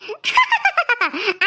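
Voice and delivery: a smug giggle at her sister; gloating laugh. Acted. A daughter laughs at her sister, smug giggle. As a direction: laugh to rub it in.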